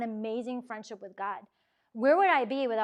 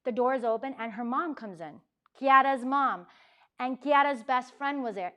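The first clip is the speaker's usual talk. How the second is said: ordinary delivery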